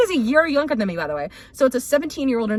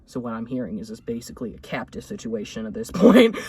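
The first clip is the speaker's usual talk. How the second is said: cowboy dad voice; 13 semitones lower than usual. Retold or imitated.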